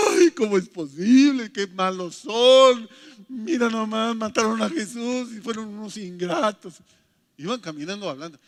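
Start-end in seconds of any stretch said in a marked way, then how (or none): 0.1-6.7 mock weeping lament. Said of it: "Iban llorando".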